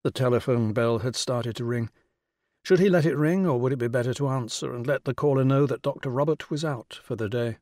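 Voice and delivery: ordinary delivery, nothing marked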